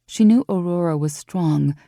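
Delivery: plain reading voice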